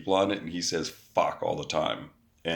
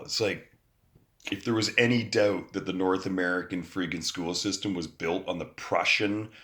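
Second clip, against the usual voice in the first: ordinary delivery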